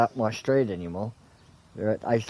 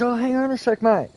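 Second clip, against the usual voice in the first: calling out; 11 semitones higher, 4 dB louder than usual. Retold or imitated. Stranger calls out from car.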